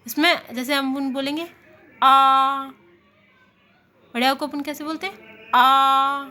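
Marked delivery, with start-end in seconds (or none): 2.0-2.6 "आ" held with the mouth fully open and loud; wide open aa. Shown as a demonstration. Teacher showing students the open vowel, loud and wide. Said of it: "पूरा मुंह खुला". 5.5-6.2 "आ" held with the mouth fully open and loud; wide open aa. Shown as a demonstration. Teacher showing students the open vowel, loud and wide. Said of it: "पूरा मुंह खुला".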